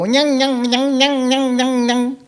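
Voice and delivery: high, nasal nyang-nyang whine; whining children. Retold or imitated. Children whining at their father, high mock whine. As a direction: mimic kids whining. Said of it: "the children go".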